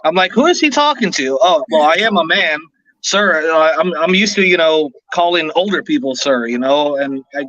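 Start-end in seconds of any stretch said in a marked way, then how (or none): 0.3-1.4 jumps much higher, puzzled; baffled inner thought. Retold or imitated. Retelling the store clerk calling him sir, baffled and high. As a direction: ask it like you can't believe he means you.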